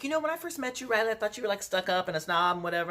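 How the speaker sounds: high, breezy imitation of a friend; mock gushing friend. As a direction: toss it off like a friend confessing. Friends' first impression, quoted.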